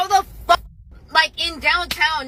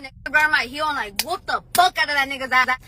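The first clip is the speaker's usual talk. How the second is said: normal talking voice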